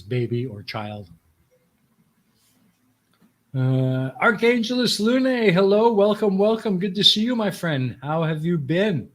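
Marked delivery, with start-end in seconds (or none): 4.2-9.1 excited greeting. Real, not acted. Streamer greeting a chat viewer warmly, high and excited. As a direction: greet the arriving friend with delight.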